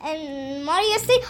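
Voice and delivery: plain reading voice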